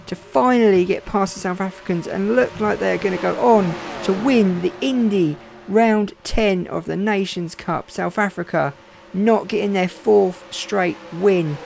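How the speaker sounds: nearly falling off the chair with excitement; overexcited race call. Real, not acted. Commentator calling the epic finish to viewers, excited and high. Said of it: "Sorry for my voice getting high then at the end. I was so excited".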